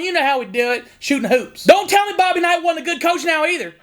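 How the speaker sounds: folksy southern Indiana hillbilly accent played for laughs. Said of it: "if I do that accent".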